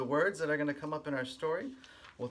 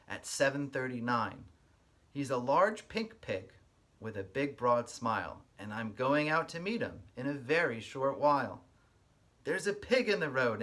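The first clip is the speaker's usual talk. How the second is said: lively storybook read-aloud with a sing-song lilt; reading to children; 6 dB louder than usual. Acted. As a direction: read it like story time for little kids. Reads picture book aloud.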